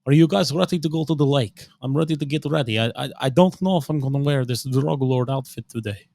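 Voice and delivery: put-on Russian accent with rolling lilt. Showing off the drug lord outfit, in a Russian accent. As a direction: talk like a Russian. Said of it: "walking around talking Russian".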